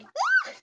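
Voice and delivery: acted high, loud shriek of surprise; short mock yelp, very high; shocked yelp. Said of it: "she looks up at him shocked".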